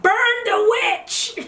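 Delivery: high, slow, loud mock mob yell with a laugh; hammed-up angry villager calling for the witch; mock mob cry. Mock crowd turning on you.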